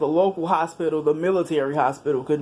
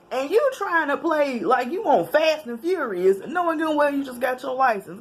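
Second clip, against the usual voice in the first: teasing; 9 semitones higher than usual. Real, not acted. Teasing the friend's driving.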